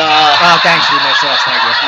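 loud laughing thanks. Real, not acted.

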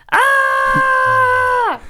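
a long, high, loud "ah"; yell. Shown as a demonstration. Explaining the game rule, yells the shout out loud. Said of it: "you have to yell".

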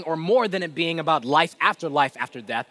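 ordinary talk, nothing marked

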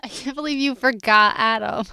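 loud, laughing disbelief. Real, not acted. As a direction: tease through laughter. Laughing teasing.

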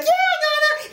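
granddaughter. Retold or imitated. Granddaughter hitting Nana with pillow, high and playful. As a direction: pipe up like a small girl.

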